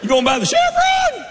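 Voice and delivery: very high, squeaky "You gonna buy the Chevron?"; incredulous local. Retold or imitated. Small-town guy at the Chevron asking in shock.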